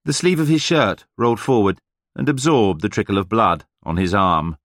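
plain reading voice

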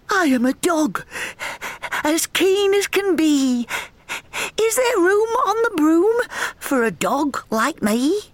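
high, bright, eager talking dog. Acted. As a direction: beg keenly for a place on the broom. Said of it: "eagerly said".

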